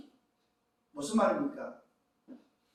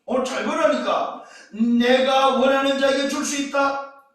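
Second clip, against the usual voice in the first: raised, driving pulpit delivery; forceful preaching; 9 semitones higher, 11 dB louder than usual. Acted. Preacher to congregation on the temptation, forceful preaching.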